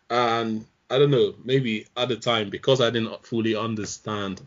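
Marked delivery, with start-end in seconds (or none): none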